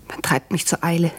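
ordinary talk, nothing marked

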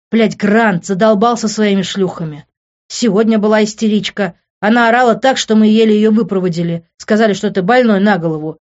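fed-up, emphatic complaint; snorting annoyance. A narrator's reading. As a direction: grumble forcefully, with a scornful edge. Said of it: "фыркнул Лес".

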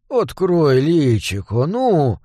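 ordinary delivery

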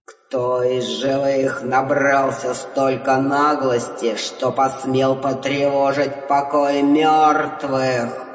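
low, slow, booming underworld god; god of the dead. Acted. (низкий медленный голос бога мёртвых)

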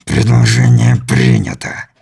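low, slow inhuman voice; slow and threatening, from deep down; menacing alien. A narrator's reading. (низкий зловещий голос чужака)

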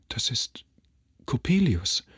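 whispered "Das ist", then the name breaks out; a mother going pale, hushed dread; mother whispers in fear as she hears the heavy steps. Acted. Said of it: "sagte meine Mutter erblassend".

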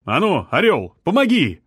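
loud, high shout across the yard; calling out. A narrator's reading. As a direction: call a young man over, commanding. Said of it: "крикнул".

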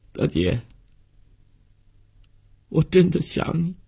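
tearful, high-pitched longing for big sister; 忍不住落泪. A narrator's reading. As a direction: voice rising high into tears. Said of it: "悠悠的眼泪 开始忍不住了". (忍不住眼泪的高声哭腔)

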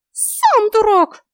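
very high, drawn-out fairy yell; furious little fairy; roaring fairy. A narrator's reading. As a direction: fling the insult in a tiny squeaky roar. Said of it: "предсказуемо взревела фея".

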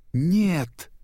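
high, drawn-out cry; dismayed shout. A narrator's reading. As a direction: cry out as something is snatched away. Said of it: "закричали Марлин с Дори".

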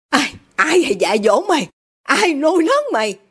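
indignant questioning that climbs high; indignant father. A narrator's reading. Father berates ungrateful daughter, indignant. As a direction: demand an answer, pitch rising.

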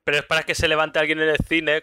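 ordinary delivery

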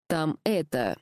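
halting, hesitant start; faltering, unsure how to say it. A narrator's reading. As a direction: stall before bad news. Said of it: "замялся Макс".

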